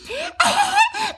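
very high, squealing laugh; high giggle. Acted. Giggles after the line.

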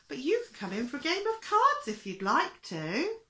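put-on high, loud lilting old lady voice; coaxing old lady. A narrator's reading. Little old lady offering the fire chief cards, high and coaxing. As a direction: invite eagerly in a high put-on voice.

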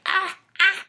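tiny, very high yelps; comic squeals. Acted. The Jubi landing with little feet up, squealing. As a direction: squeak out two short mock cries. Imitates Jubi's feet after falling.

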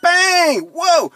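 high, loud triumphant whoop; excited cheer. Real, not acted. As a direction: celebrate a win out loud. App finally accepts the answer.

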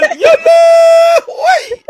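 high, slow drawn-out mock shriek; imitating wife. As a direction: stretch it out like a startled wife. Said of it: "مراتي نايمة وقال".

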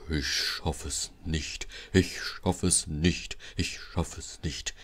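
slow, low, soft, laboured puffing; weary, straining and drawn out. A narrator's reading. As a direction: heave it out like an exhausted engine. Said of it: "schnaufte Gordon".

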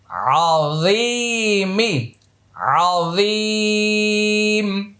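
আযীম stretched very slowly, three alif long; long madd. Shown as a demonstration. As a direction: hold the vowel of আযীম long. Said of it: "তিন আলিফ লম্বা করতে হবে". (আযীম তিন আলিফ টেনে)

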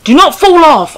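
high, loud urgent command; alarmed warning shout. Real, not acted. Gamer to character on ledge, alarmed shout. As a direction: shout it as a desperate order.

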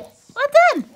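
high squeaky praise for a dog; squeaky praising a dog. Real, not acted. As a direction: gush at the puppy in a squeal. Praising the dog after a trick.

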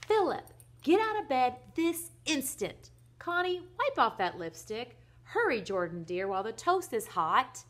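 loud, impatient mother's shout upstairs; loud call, high then easing down. Acted. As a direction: holler orders to the family, starting high. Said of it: "called again louder".

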